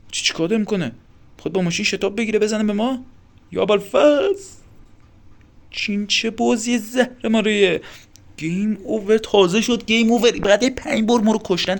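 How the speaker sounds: high, loud, indignant outburst; alarmed outrage. Real, not acted. Player reacts to the car attack and game over, alarmed outrage. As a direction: cry out at an unfair death.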